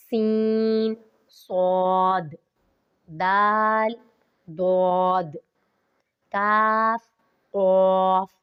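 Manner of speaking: each letter named slowly and distinctly; clear letter names. Shown as a demonstration. Teacher listing similar-sounding letters, careful enunciation. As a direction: sound out every letter name crisply. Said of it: "جن میں آ جاتا ہے".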